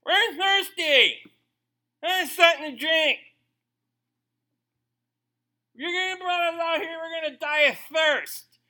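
high, loud whiny complaining voice; whining Israelites. Retold or imitated. Thirsty Israelites complaining to Moses, whining high and loud. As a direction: whine like spoiled kids in the back seat. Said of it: "then they get to Marah".

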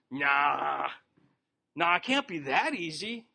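a mild, skeptical brush-off of the preacher; doubtful scoffing. Retold or imitated. A doubter waves off the preacher's offer, mildly scoffing. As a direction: wave the idea away like a doubter.

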